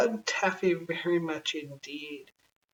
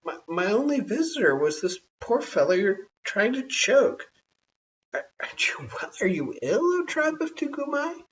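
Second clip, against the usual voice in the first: higher, a hint of a fussing man; lightly voiced flustered man; 7 semitones higher, 4 dB louder than usual. A narrator's reading. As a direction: fuss over the tribe. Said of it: "said Tugumai".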